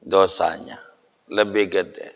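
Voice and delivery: a low, hushed echo of the point; quiet repeat. Shown as a demonstration. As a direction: drop the voice on "Dosanya lebih gede".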